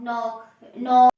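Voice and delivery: नॉक stretched out and said loudly; slow modeled word. Shown as a demonstration. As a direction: drag out नॉक to model its vowel. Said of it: "teacher modeling words". (नॉक को खींचकर ज़ोर से बोलें)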